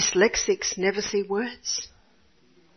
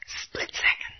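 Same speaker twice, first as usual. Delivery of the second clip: whisper; 5 dB quieter than usual. Real, not acted. Preacher stressing a point softly.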